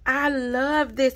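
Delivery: ordinary talk, nothing marked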